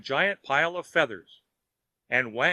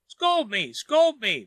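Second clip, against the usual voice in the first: building from plea to high, loud cry; begging; 8 semitones higher, 5 dB louder, slower than usual. A narrator's reading. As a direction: beg harder on the second "scold me". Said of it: "begged Wang".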